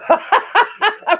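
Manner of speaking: high-pitched burst of laughter; helpless high giggle; real cackle. Real, not acted. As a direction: cackle at your own slip. Amused by own slip.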